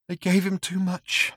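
high, slow, small whimpering voice; tiny whimper. Acted. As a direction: whimper it out helplessly. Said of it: "he said in a tiny whimper".

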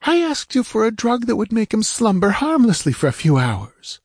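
high, aggrieved woman's voice; high-pitched bitter protest. A narrator's reading. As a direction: accuse him bitterly. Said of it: "she said bitterly".

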